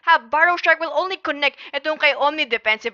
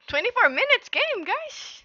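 amazed; 12 semitones higher than usual. Real, not acted. Disbelief at the short game.

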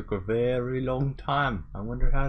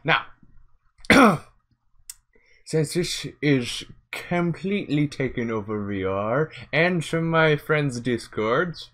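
usual speaking voice

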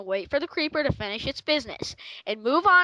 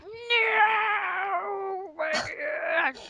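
higher, crying-out mock despair; mock dismay; 6 semitones higher, much slower than usual. Friend retelling his reaction to the virus. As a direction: wail it at the infected computer. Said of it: "I'm like".

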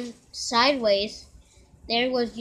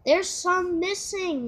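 growled; 7 semitones higher than usual. Acted. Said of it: "Nate growled".

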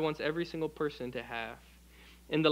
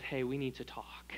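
breathy hushed warning, barely voiced; ominous whisper; 6 semitones lower, 10 dB quieter than usual. Preacher mimics someone with bad news, whispering.

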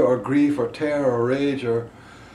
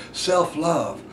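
normal talking voice

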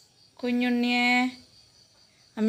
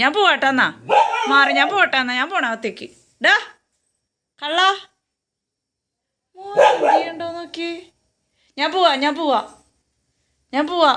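mock threat to leave; 6 semitones higher, 4 dB louder than usual. Real, not acted. Mock leaving to lure the dog.

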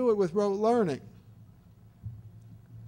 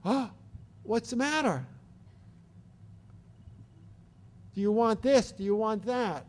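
caregiver checking in. Shown as a demonstration. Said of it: "the educator might go".

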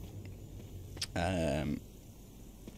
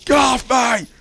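panicked yell; 13 semitones higher, 14 dB louder than usual. Real, not acted. Attacked in game.

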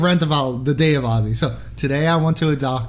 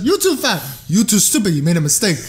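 Russian grandmother. Retold or imitated. Nurse blaming her patient, Russian-accented scolding. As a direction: berate him as a blunt Russian grandma. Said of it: "she says".

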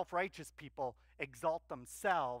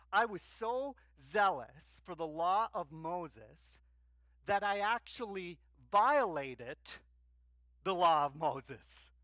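voicing Paul; 2 semitones higher, 7 dB louder than usual. Preacher voicing Paul's confession.

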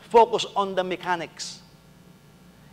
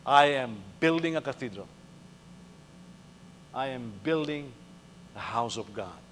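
proud; 5 semitones lower, 7 dB quieter than usual. Retold or imitated. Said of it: "He said this proudly".

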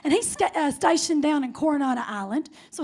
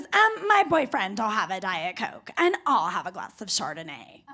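mimicked snooty customer; 7 semitones lower than usual. Customer orders from the bartender, mocked haughtiness. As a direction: imitate her haughty drink order.

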